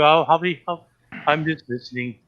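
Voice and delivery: ordinary talk, nothing marked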